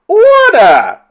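very high, loud call to order; piercingly pitched chairwoman's cry; cried command. A narrator's reading. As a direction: shout down the room. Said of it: "cried the chairwoman".

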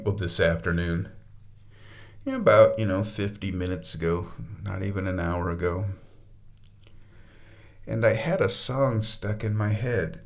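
usual speaking voice

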